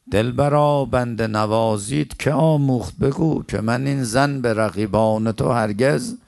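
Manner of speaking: loud, drawn-out verse declaimed to the room; impassioned poem recitation. Acted. Preacher breaks from the story into verse, impassioned recitation. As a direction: declaim the verse in full voice, stretching the words.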